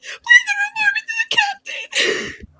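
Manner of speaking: very high, tearful squeal; panicked plea. Acted. Begging Cyril not to report to captain, panicked. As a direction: beg in a strained, sky-high whimper.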